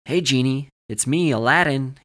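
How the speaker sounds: loud, jokey mock summons; playful calling out. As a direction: call out teasingly to an imagined genie. Joking mock summons to a genie.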